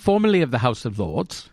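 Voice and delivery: put-on English lord's voice; mock-aristocratic English accent. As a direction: state your title as an English lord. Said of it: "I've heard you with the English accent".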